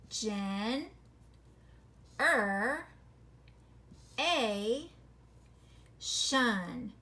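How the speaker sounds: "gen, er, a, shun" each piece split apart with pauses; syllable breaks. Shown as a demonstration. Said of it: "the syllable breaks make sense".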